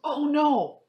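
loud, high cry of dismay; alarmed. Acted. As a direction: shout it in surprise. Sudden alarm at snowstorm.

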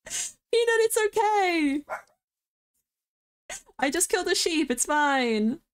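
high, reassuring call to a pet; calming the barking dog, high pitched. Real, not acted. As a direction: soothe the dog from across the room.

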